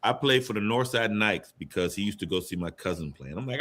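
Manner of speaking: ordinary talk, nothing marked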